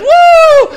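loud, very high, long "woo"; imitating an ecstatic worshiper's holler; shouted whoop. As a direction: let out a piercing revival whoop. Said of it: "he was shouting, he was going".

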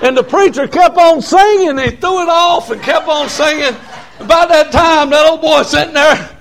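high, excited revival storytelling; fired-up country preacher; full-force preaching. Real, not acted. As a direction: preach the tale with mounting excitement, pitched high.

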